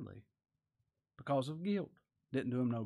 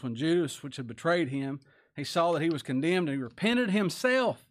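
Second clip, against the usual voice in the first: louder proclaimed scripture; fervent scripture reading; 3 semitones higher, 5 dB louder than usual. Real, not acted. Preacher reading Matthew to the congregation, loud. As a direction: declare it to the room.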